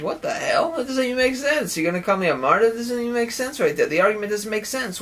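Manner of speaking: acted high-pitched protest; indignant disbelief. Acted argument over being called martyr.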